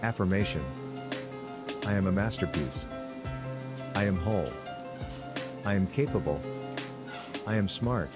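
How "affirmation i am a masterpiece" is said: ordinary talk, nothing marked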